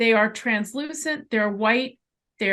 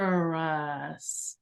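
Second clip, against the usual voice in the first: "Arcturus" drawn out very slowly, lower and softer, with a rise and fall; slow sing-song "Arcturus", lower and softer; up and down tune; 7 semitones lower, 6 dB quieter, much slower than usual. Retold or imitated. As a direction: let "Arcturus" float up and down like a distant voice. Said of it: "Like that, with the up and down".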